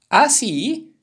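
high, loud surprised reply; shouted question. A narrator's reading. Turtle answering the animals below, shouted question. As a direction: call it back down at high pitch. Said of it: "gridò la tartaruga".

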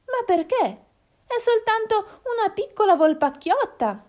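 high-pitched, puzzled protest; high little hen voice, taken aback; surprised little hen. Acted. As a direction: ask in innocent astonishment. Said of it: "si stupì Gallinella".

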